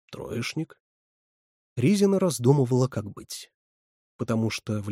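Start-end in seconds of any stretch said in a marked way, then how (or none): 0.1-0.8 subdued answer. A narrator's reading. The boy admits his grades, subdued. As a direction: admit it quietly.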